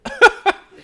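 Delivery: shrieking laugh, high and loud. Real, not acted. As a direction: burst out laughing. Laughing at creepy voice.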